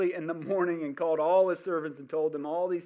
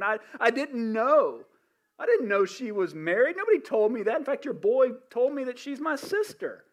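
louder, higher, pleading and indignant; the king blaming Abraham's lie, louder and higher; 6 semitones higher, 6 dB louder than usual. Acted. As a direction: argue your innocence to God, voice climbing. King negotiating with God.

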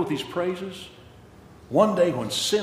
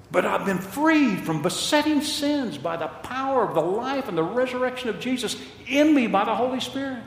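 louder, lifted pulpit voice; fervent preaching; 7 semitones higher, 5 dB louder than usual. Acted. Pastor testifying to congregation about freedom, fervent preaching. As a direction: drive it home with conviction.

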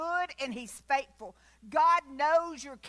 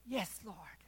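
whispered, much softer; awed whisper; 5 semitones lower, 9 dB quieter, slower than usual. Pretend. Believer hearing God's praise, awed whisper. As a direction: breathe it in hushed awe. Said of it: "I'm gonna be so tuned in".

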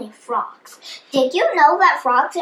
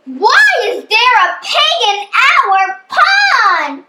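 higher, louder startled question; indignant frogs; 6 semitones higher, 5 dB louder than usual. A narrator's reading. Frogs find a pig in their pond, indignant surprise. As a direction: demand an answer in mock outrage.

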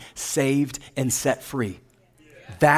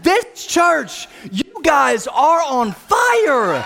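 full-out high, loud rallying shout; emphatic repeat; 12 semitones higher, 5 dB louder, much slower than usual. Real, not acted. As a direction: shout it bigger to rouse the crowd, stretching the last word. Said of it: "I said".

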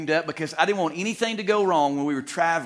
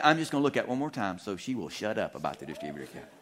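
grumbling thought; 6 semitones lower, 5 dB quieter, faster than usual. Retold or imitated. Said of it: "I'm thinking".

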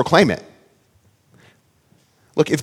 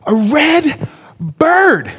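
put-on high, slow, drawn-out child voice; insisting like a four-year-old; little boy repeating himself; stubborn toddler; 11 semitones higher, 3 dB louder, much slower than usual. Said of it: "He's like".

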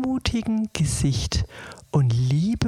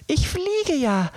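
mildly amazed, pitched well up; a higher-voiced touch of wonder; astonished; 10 semitones higher than usual. A narrator's reading. Marie finds herself flying with the fairy, mildly astonished. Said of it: "rief Marie erstaunt".

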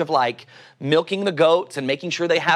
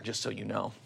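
ordinary talk

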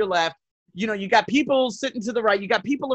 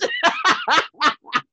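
very high, squealing laugh; real helpless cackling laughter; 3 dB louder than usual. Real, not acted. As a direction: burst out laughing at your own story. Laughing at uncle story.

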